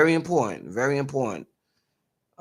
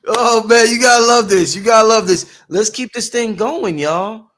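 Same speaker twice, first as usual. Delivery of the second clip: loud, high hype shout to the chat; excited hype; 7 semitones higher, 9 dB louder than usual. Real, not acted. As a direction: pump up the audience, much louder and higher. Host hyping the audience.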